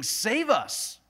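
high, loud jeering shout, voicing the taunting criminal; mocking criminal's yell. Criminal on the cross taunting Jesus, a loud high mocking yell. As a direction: throw the mockery up at him, loud and high. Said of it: "is yelling at, at Jesus, saying".

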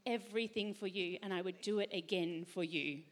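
a soft reassuring promise; tender Father. Retold or imitated. Preacher voicing God's words to his child, tender and soft. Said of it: "he would say".

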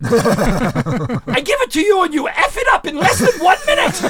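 high, loud, drawn-out exasperation; slow yell climbing to a peak at the end; mock shouted rant. Brewmaster berating Phil, slow high mock yell.

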